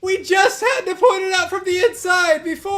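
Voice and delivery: high, loud complaint; heated protest at the game; exasperated yelling. Real, not acted. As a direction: shout it in frustration. Exasperated at repeating game task.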